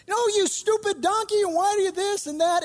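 high, scolding retort at a stubborn animal; exasperated yell. Retold or imitated. Preacher voicing Balaam scolding his donkey, hammed-up angry yell. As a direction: snap at the donkey in mock fury.

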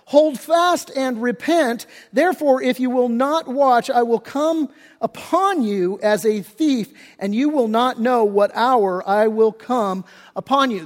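preaching scripture. Acted. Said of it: "He says".

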